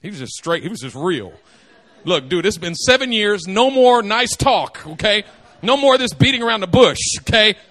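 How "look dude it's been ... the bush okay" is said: louder and louder, fed up; blunt demand building. Retold or imitated. Jacob demanding his wife from Laban, building to loud. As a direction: push harder each line till it bursts. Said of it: "Jacob goes to Laban and says".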